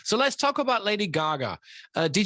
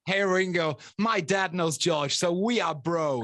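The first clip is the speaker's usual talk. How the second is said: drawn-out, smug mock bragging; slow mock boast; slower than usual. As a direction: stretch it out like a kid showing off. Mocking a name-dropping kid.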